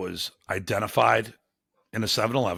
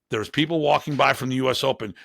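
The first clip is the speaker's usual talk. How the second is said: normal talking voice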